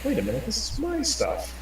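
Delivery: high, outraged double take; indignant realization. Retold or imitated. Villager realizing the elf stole his goods, high indignant squeak. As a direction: squeak out the discovery.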